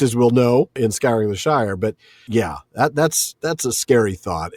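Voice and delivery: ordinary talk, nothing marked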